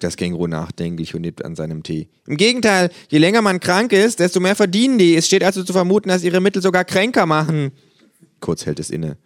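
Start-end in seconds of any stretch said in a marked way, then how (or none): 2.3-7.7 high, insistent complaining voice; kangaroo theorist. Acted. Kangaroo over tea accusing the drug industry, insistent voice. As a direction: press the accusation with conviction.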